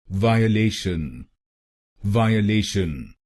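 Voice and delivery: वायलेशन said slowly as "vaa-ye-le-shan", four syllables; correct pronunciation. Shown as a demonstration. Teacher modelling the right way, slow careful pronunciation. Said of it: "इसकी सही pronunciation है".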